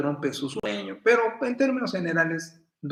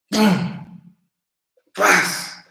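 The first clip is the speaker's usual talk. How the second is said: sharp, louder bursts for the sudden jump; mock sound effects; 5 semitones higher, 7 dB louder than usual. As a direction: punch out each noise, louder. Mother springs out of bed. (onomatopeyas fuertes y marcadas)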